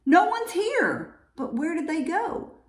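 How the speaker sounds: high-pitched puzzled exclamation; bear's baffled cry. A narrator's reading. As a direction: exclaim it up high, then wonder. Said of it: "Bear cries".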